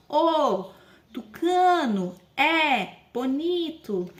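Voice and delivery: "O tucano é bonito" said slowly, every word apart. Shown as a demonstration. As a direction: count the words out slowly and clearly. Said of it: "levantar um dedo pra cada palavra".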